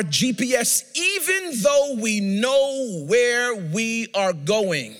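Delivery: preaching. Real, not acted. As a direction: preach it, drawing out the final phrase. Preaches the point.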